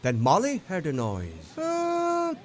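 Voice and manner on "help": a far-off call, much higher; high cry for help. A narrator's reading. Someone in trouble calling for help.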